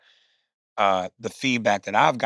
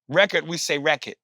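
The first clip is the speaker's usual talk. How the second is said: ordinary talk, nothing marked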